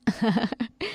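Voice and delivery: a short taunting laugh; mocking neighbour laugh. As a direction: laugh at her from the next roof. Neighbour mocks Payal from roof. (मज़ाक उड़ाती हँसी)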